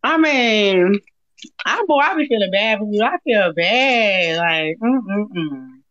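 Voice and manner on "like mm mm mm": low, throaty Gollum-like hum. Pretend. Mocking a sad troll on the live, Gollum-style hum.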